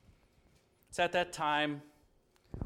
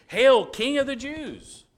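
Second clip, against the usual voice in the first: loud, high mocking hail; mock royal proclamation; 10 semitones higher, 11 dB louder than usual. Pilate mockingly hailing the king to the crowd, loud.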